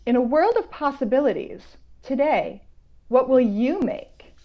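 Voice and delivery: put-on slow, expressive read-aloud for kids; reading a picture book. Author reading her picture book to young viewers, gently expressive. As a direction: savour each rhyme for young listeners.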